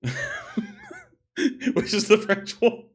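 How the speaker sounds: words squeezed out through laughter, high-pitched; laughing. Real, not acted. Podcaster amused at own French league name, laughing. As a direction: laugh helplessly while talking.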